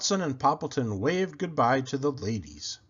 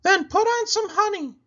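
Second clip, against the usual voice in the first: much higher, bright llama voice; a llama giving friendly advice; Cherry Sue voice; 7 dB louder than usual. A narrator's reading. Llama advising her friend the pig, high and sweet. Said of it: "said Cherry Sue".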